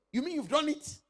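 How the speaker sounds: high, loud disbelieving delight; amazed. Retold or imitated. Customer to tailor, amazed the clothes are ready early. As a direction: sound stunned the job is already done.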